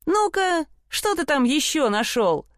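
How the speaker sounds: high, lightly mocking query; teasing brothers. A narrator's reading. Brothers quiz the simpleton on his find, teasingly. Братья насмешливо расспрашивают Ганса.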